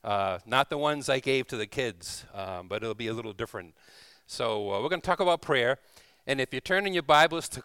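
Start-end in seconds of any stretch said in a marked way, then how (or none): none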